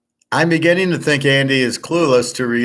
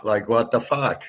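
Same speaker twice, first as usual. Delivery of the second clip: ordinary talk, nothing marked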